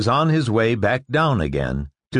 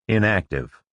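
ordinary delivery

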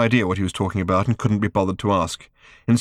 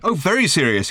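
sarcastic raised voice; mock shout; 9 semitones higher than usual. A narrator's reading. Arthur mocking Ford's urgency, sarcastic shout. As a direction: throw the words back mockingly. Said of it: "he shouted".

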